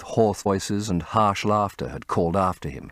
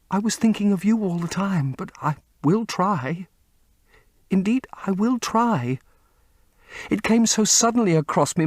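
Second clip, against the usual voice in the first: put-on high, tearful girl's voice begging him to stay; a heartbroken young woman clinging to her love; pleading young actress; 14 semitones higher, 3 dB louder than usual. A narrator's reading. Sibyl begging Dorian at his feet, high and tearful.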